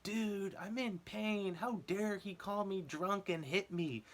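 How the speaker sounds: high, slow whiny protest; complaining injured guy. Retold or imitated. Back inside, imitating the injured guy's high slow whine. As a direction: whine like the hurt homeless guy. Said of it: "he was like".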